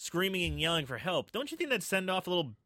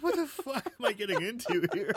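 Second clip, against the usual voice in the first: laughing; 5 semitones higher than usual. Retold or imitated. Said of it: "I'm like".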